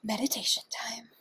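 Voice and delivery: soft breathy whisper of an announcement. Real, not acted. Whispering to viewers that meditation time has come. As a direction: whisper it like a secret treat.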